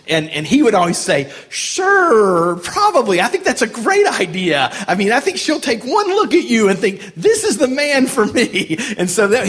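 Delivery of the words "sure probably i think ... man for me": high-pitched, gushing mock encouragement; sarcastic buddy rubbing it in; master of sarcasm. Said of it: "he was the master of sarcasm".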